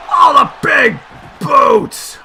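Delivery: loud, punched-out move call; excited shout. Real, not acted. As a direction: bellow the hit as it lands. Calling a kick.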